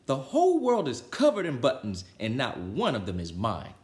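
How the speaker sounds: a tantrum, stamping and yelling; Toad screaming. Acted. Furious Toad yelling at the world about buttons, hammed-up tantrum. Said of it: "jumped up and down and screamed".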